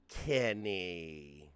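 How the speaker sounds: usual speaking voice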